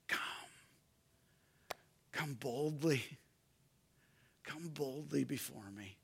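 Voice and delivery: tender voice of God. Acted. God quietly welcoming the sinner, tender and hushed. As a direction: invite them in quietly, like a loving father. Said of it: "he says".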